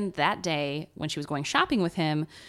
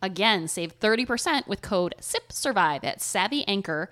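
ad read; 2 semitones higher, 4 dB louder than usual. Acted. Ad read closing.

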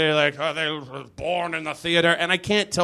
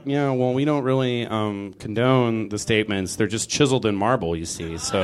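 lower, sheepish official excuse; museum staff; 7 semitones lower than usual. Retold or imitated. Comic imitation of a museum person explaining, sheepish. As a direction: play a mildly awkward museum worker.

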